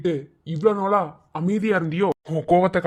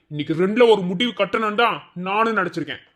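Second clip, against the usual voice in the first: ordinary talk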